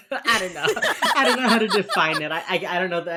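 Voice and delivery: laughing loudly through the words. Real, not acted. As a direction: breaks into laughter mid-sentence. Laughing at herself.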